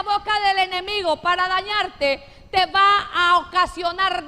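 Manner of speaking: loud, slow, fervent proclamation; preaching at full force. Acted. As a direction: drive the promise home to the church. Preaching over the congregation.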